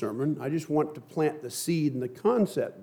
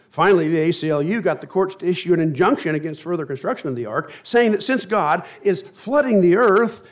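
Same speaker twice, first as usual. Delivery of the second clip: rising, louder list of grievances; lightly voiced Noah, exasperated, ranting to the Lord; 5 semitones higher, 6 dB louder than usual. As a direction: rattle off the complaints, fed up. Noah's long complaint, retold animatedly.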